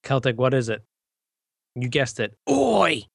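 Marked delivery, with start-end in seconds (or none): 2.5-3.0 high, loud Australian "Oi!"; Australian accent. Acted. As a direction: shout it like a mock Aussie. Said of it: "say it in your Australian accent".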